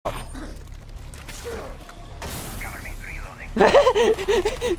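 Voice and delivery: breathless wheezing laugh; high whispery laugh. Real, not acted. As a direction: laugh almost silently, high. Laughing at teammate's line.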